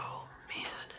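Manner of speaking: a soft, voiceless sigh of dread; dreading whisper. Retold or imitated. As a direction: whisper it like your heart just sank. Dreading the question about dad.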